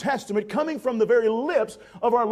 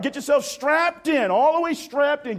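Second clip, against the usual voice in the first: normal talking voice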